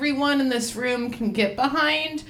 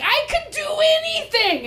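euphoric. Retold or imitated. Quoting her shroom high.

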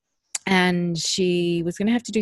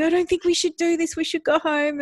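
much higher imitated child's plea; anxious child pleading; 11 semitones higher, 4 dB louder than usual. Daughter in the car pleading with mother, imitated anxiety. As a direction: mimic a worried child begging out, pitch raised. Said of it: "she's in the car going".

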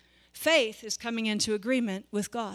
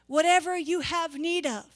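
higher, louder declaring God's words; impassioned preaching; 4 semitones higher, 4 dB louder than usual. Acted. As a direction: proclaim it as God's own promise. Voicing God's promise, preaching.

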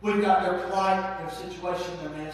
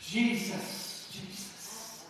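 whispered Jesus; 9 dB quieter, much slower than usual. Real, not acted. Awed aside between sermon points.

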